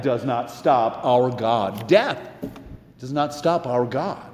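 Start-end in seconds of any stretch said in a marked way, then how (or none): none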